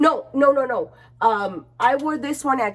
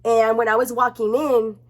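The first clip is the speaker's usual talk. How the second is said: normal talking voice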